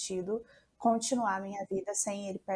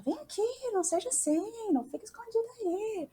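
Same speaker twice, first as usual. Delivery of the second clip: relatives calling her; 13 semitones higher than usual. Retold or imitated. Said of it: "me chamaram lá na cozinha".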